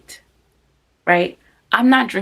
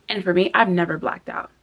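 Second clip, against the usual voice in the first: ordinary talk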